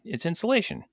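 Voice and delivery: high, matter-of-fact voice; homeless guy. Retold or imitated. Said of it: "And he's".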